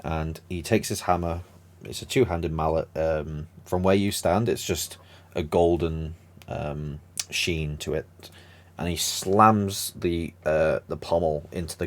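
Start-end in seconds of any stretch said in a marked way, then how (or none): none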